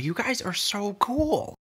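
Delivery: very high, slow gushing; awed admiration. A narrator's reading. Jory learning of the talking familiar, awed. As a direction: draw it out in starry-eyed wonder.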